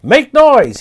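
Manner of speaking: high, loud urgent shout; rally everyone with a yell. Acted. Papagayo urging the creatures, loud high shout. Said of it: "he shouted".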